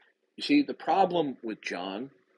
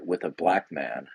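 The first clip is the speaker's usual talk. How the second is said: ordinary talk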